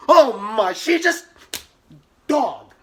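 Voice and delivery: loud, high outraged cry; outraged exclamation. Retold or imitated. Ranting in her head at the accuser, loud and high. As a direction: blurt it out in disbelief. Inner rant at courtroom.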